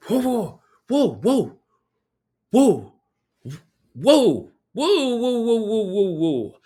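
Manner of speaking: high, loud run of startled whoas; alarmed whoa. Retold or imitated. Teacher startled by the death warning, high alarmed whoas. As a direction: pile on the whoas like you've been hit with bad news.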